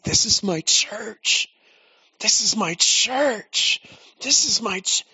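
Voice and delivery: high, slow, delighted voice of Jesus; giddy and excited. Retold or imitated. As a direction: savour every word, giddy with joy. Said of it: "giddy, excited".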